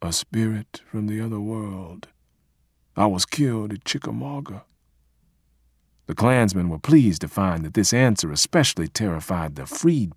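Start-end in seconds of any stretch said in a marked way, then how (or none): 0.0-4.6 soft eerie ghost voice; quiet, hollow spirit from beyond; haunting voice. A narrator's reading. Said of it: "replied in eerie voices".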